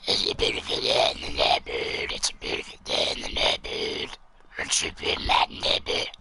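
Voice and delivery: rasping, unvoiced zombie croak. Shown as a demonstration. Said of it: "I like to call it the zombie voice".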